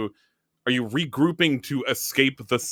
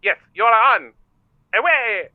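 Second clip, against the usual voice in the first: high, loud mock accepting challenge; hammed-up eager clerk; concierge voice; 9 semitones higher, 6 dB louder than usual. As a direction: accept the race with gusto.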